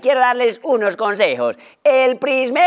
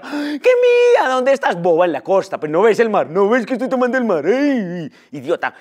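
mock show-off beach tourist. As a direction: brag about the sea to a jealous friend. Mocking friends' show-off photo talk.

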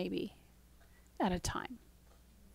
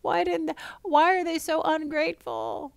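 higher, exasperated mock complaint; a mock-frustrated reader, pitched up and pleading; 8 semitones higher than usual. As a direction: throw up your hands at the stubborn Israelites. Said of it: "the reader may become very frustrated, going".

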